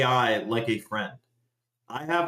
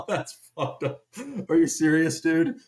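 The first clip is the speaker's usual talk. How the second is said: usual speaking voice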